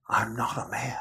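sudden hushed whisper, no voice. Acted. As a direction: drop to a breathless whisper on the quote. Quoting Christ's cry in Psalm.

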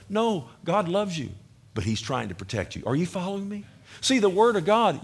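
ordinary delivery

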